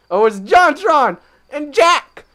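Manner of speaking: loud, high, drawn-out cry of recognition; excited outburst. Acted. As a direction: shout it out like spotting a star. Excited reaction on spotting JonTron.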